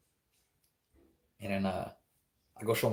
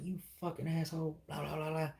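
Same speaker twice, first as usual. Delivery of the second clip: high, softer mock cussing; indignant mock tirade, pitched up; retold cussing out; 7 semitones higher, 5 dB quieter than usual. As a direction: act out cussing someone out, held back in volume. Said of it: "start cussing him out".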